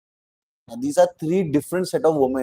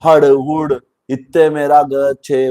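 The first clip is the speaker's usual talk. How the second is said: louder, slow mock Punjabi accent; 7 dB louder, slower than usual. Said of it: "talks Punjabi in that accent".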